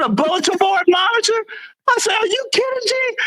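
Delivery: high, fast, indignant disbelief; incredulous outburst. Retold or imitated. Sergeant retells hearing about the bulletin board job, incredulous. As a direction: voice leaps up in outraged astonishment. Retold disbelief at supervisor's answer.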